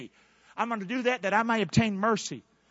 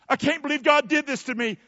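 mock blaming; 8 semitones higher, 5 dB louder than usual. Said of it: "You, the blame game".

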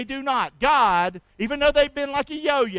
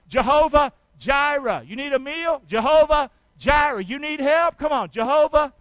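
loud, raised, insistent preaching; rousing call-and-response; 4 semitones higher, 5 dB louder than usual. Real, not acted. Preacher getting congregation to say Jehovah Jireh, rousing loud refrain. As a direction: drive the crowd to answer each need. Congregation chants the name with him.

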